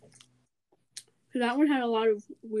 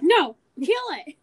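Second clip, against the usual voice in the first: loud protest; 6 semitones higher, 9 dB louder than usual. Retold or imitated. Friend refusing embarrassing topic.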